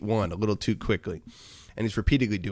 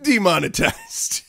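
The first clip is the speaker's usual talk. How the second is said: slow, raised deadpan pronouncement; mock announcement; 7 semitones higher, 4 dB louder, slower than usual. Joking to chat, a slow mock verdict. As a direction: drop the word like a punchline verdict. Joking about a merch idea.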